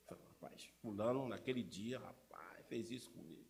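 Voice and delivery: hushed whisper, passing on gossip behind a brother's back. Retold or imitated. Church member whispering a grudge to everyone else, hushed. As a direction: lean in and whisper the complaint like gossip. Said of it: "a gente fala com todo mundo".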